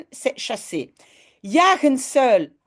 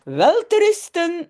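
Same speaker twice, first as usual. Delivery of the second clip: higher, louder sweet goodnight; lightly voiced kindly aunt tucking children in; aunt Mies saying goodnight; 8 semitones higher, 4 dB louder than usual. Teacher reading the story to students, lightly voicing the aunt. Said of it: "zegt tante Mies".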